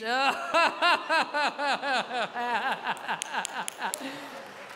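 long run of evenly pulsed laughs, mock-posh; imitated rich laugh. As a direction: mimic a rich person's drawn-out chuckle. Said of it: "même votre rire, il est riche". (rire de riche, longuement imité)

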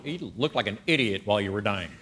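low, quiet mocking put-down; deadpan teasing. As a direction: drop low and tease them dryly. Heaven's people teasing him forever.

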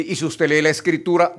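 ordinary talk, nothing marked

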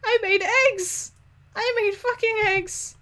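very high, boastful squeal; hammed-up excited learner; proud game character. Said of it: "She's like".

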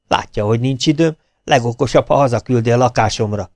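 usual speaking voice

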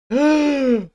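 sharp, high gasp of delight; excited gasp. Real, not acted. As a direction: gasp as if spotting treasure. Sees the big cylinder.

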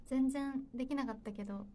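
usual speaking voice

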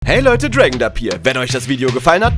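normal talking voice

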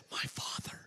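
put-on little boy's hushed whisper. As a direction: whisper secretly into the phone. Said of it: "came back on the phone whispering".

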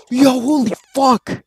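high startled yell. Real, not acted. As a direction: jump at the scare, yell. Jumpscare in horror game.

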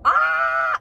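sudden loud shriek; excited shriek. Real, not acted. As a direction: squeal it out in delight. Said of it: "And I start freaking out".

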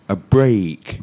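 teacher's clear, stretched "ay" in BREAK; demonstrating long A. Shown as a demonstration. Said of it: "it's an A sound".

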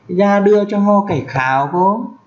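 câu tiếng Mường "Ra đưa cho ho cải kháo không?" lên giọng cao; đọc câu Mường cao và ngân. Shown as a demonstration. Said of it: "mày đưa cho tao cái lược". (giọng Mường cao, lên cuối câu)

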